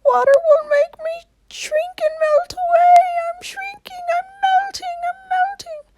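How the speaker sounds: very high wailing cry; melting witch. Acted. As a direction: wail as she melts away.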